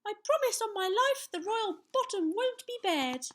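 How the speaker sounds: put-on high, loud boyish pledge; little knight declaring. A narrator's reading. Young knight promises to save the royal bottom, declaring. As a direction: proclaim it high and proud. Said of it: "Sir Scallywag declared".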